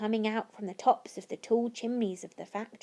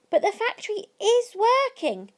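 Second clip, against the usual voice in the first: much higher, loud amazed shout; 11 semitones higher, 5 dB louder than usual. A narrator's reading. Crowd at the locked gates, amazed shouting. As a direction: call out in wonder. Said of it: "the people shouted".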